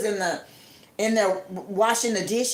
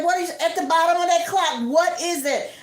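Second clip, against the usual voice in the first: alarmed question; 6 semitones higher than usual. Retold or imitated. Friend alarmed at the clock.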